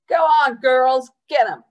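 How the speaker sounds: rowdy egging-on; calling out. A narrator's reading. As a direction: holler encouragement from the sidelines. Guard cheers on the chase.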